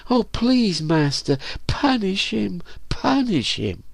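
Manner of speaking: high falsetto, tearful begging; put-on tearful pleading, high-pitched; weeping plea. A narrator's reading. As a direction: beg in a thin girlish voice. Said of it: "she wept".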